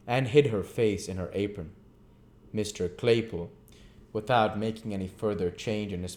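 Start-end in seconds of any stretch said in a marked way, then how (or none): none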